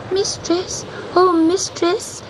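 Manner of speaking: high, drawn-out kitten cry; a little cat calling up to the witch. Acted. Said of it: "he cried above the storm".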